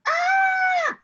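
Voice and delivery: very high, loud "Ah!" shriek; high scream. Shown as a demonstration. Teacher shows kids the voice going up, high scream. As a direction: shriek up high to show sharp. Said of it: "our hand screamed".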